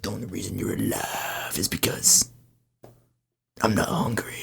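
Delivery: low, soft, whispered threat; menacing whisper. Acted. The were turkey threatening the town, low menacing whisper.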